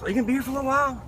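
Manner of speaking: high, fast eager ask; lightly voiced eager stranger; quick question. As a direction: rattle it off eagerly. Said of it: "he goes".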